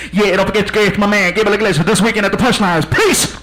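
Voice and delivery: radio DJ. Acted. DJ promotes the comedian after the show, put-on announcer voice. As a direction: imitate a host signing off. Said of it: "it was all part of the show".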